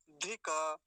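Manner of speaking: धिक said "dhika" with the vowel sounded; धिक in two full syllables; full final a. Shown as a demonstration. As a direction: open the end of धिक. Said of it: "इसको धिक नहीं बोलेंगे".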